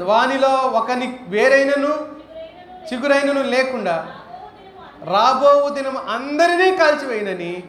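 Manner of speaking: slow, weighty reading of a Bible verse; solemn scripture reading. Acted. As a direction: read it out like holy scripture, measured and grave. (గంభీరంగా లేఖనం చదవడం)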